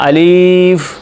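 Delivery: अलिफ said the wrong way, drawn out and distorted; wrong alif. Shown as a demonstration. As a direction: show the incorrect अलिफ for the class. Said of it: "ये गलत है, आपने नहीं पढ़ना".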